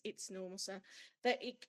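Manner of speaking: normal talking voice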